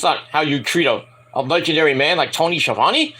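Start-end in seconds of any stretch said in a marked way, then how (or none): none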